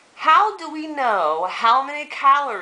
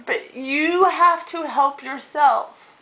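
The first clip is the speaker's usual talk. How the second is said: emotional; 8 semitones higher, slower than usual. Real, not acted. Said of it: "I'm so emotional".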